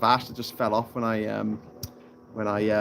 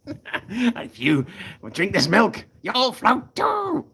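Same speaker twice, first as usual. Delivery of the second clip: slow clown menace rising to a high finish; hammed-up creepy clown, stretching the words; Pennywise; 8 semitones higher, much slower than usual. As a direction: build a mock threat, ending high and drawn out. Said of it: "Pennywise impression".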